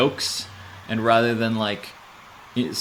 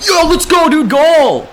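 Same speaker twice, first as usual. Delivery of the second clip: very high, loud victory shout; goal celebration; 12 dB louder, slower than usual. Real, not acted. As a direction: yell it like you just scored. Scores a goal.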